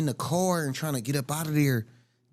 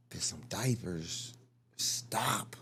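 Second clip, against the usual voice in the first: whispering; 10 semitones lower, 7 dB quieter, slower than usual. Real, not acted. Reasoning through the scenario aloud.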